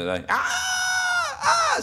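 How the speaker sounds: shrill high shriek from the bride; bride's scream. Retold or imitated. Joke narrator voicing the bride's night scream, high shriek. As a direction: let out a piercing high scream. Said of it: "on entend un grand cri".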